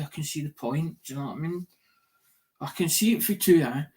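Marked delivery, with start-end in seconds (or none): none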